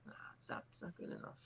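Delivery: very soft, whispered refusal; hushed, voiceless brush-off; dismissive whisper. Acted. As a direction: whisper it as the bully turning down an offer. Bully dismisses Mark's offer.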